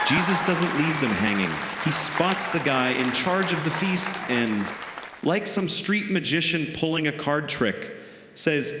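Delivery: usual speaking voice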